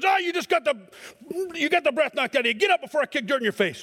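gruff coach. Retold or imitated. Coach tells the winded player to get up, brusque. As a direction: brush off the hurt, order him up. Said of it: "the coach comes out and he goes".

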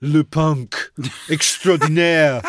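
loud, drawn-out French MC announcement; hammed-up French announcer voice; mock announcer. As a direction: boom it like a club compere. Mock announcer introducing the band.

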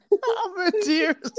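high, drawn-out squeal through real laughter; real crying-with-laughter voice, high and slow; in tears laughing. Real, not acted. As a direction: squeeze it out slowly while helpless with laughter.

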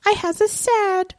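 high, babyish mock sulk; mock sad. Delia sulking at her mum, high babyish mock sadness. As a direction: whimper it like a sulky child. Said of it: "And Delia's like".

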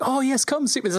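the GM voicing townsfolk inviting the hero, pitched high; high welcoming crowd voice. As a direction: gush it high and friendly.

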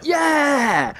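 high gleeful yell; mock cheer while bouncing; excited yell. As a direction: whoop with glee on the bridge.

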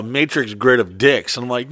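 usual speaking voice

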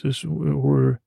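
normal talking voice

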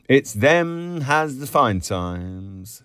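slow, drawn-out wistful complaint; sad. A narrator's reading. Miss Kennedy envies men to her friend, slow and mildly sad. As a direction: draw it out high, with a touch of envy. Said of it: "sadly then she said".

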